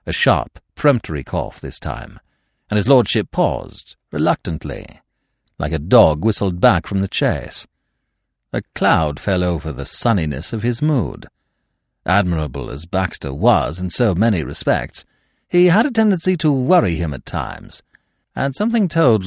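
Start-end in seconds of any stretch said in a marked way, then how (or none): none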